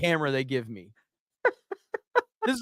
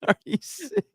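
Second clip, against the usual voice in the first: mock concern; 12 semitones higher, 3 dB quieter than usual. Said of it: "somebody said".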